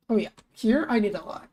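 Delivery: normal talking voice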